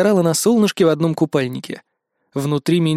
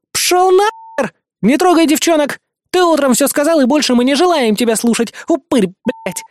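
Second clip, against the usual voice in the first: high, loud angry tirade; Helen's furious growl; 10 semitones higher, 3 dB louder than usual. A narrator's reading. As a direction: drive him away with furious insults. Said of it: "прорычала Хелен".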